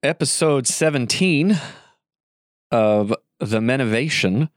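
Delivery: slow, drawn-out show opener; announcer intro. Acted. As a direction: announce the episode like a host.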